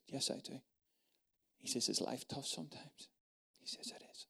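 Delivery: very soft, near-whispered back and forth; whispered table conversation; hushed dialogue. Retold or imitated. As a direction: keep it barely audible, intimate.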